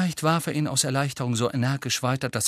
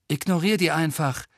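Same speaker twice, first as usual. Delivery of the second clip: slightly raised, higher-pitched call; mild call; 6 semitones higher than usual. A narrator's reading. Harry flies up to Ron with advice, mild call. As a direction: call over to a teammate. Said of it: "rief er".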